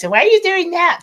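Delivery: put-on high, whiny child's question; whiny little kid voice. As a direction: whine it like a small girl. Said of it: "like, whiny little voice".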